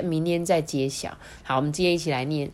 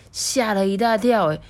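plain reading voice

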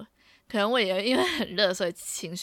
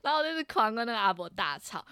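normal talking voice

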